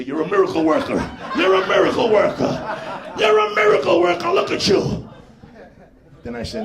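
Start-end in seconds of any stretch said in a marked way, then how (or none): 0.0-5.1 mock praise building. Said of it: "rub his penis and say".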